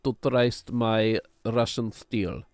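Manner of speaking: slow, deliberate mock Russian; Russian accent. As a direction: put on a Russian comrade's accent. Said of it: "I haven't done a Russian accent in a long time".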